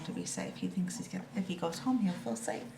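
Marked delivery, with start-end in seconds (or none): none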